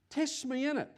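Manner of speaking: ordinary delivery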